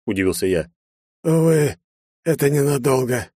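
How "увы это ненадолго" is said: higher strained voice of a dying man; breathed-out dying words. A narrator's reading. Dying chief answers the hero, strained exhalation. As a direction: let the bad news out on a breath. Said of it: "выдохнул он".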